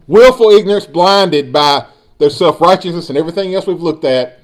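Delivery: usual speaking voice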